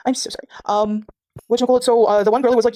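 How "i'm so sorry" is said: ordinary talk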